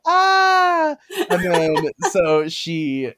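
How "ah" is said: high loud startled scream. Retold or imitated. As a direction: shriek as your hair gets pulled. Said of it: "And then she's like".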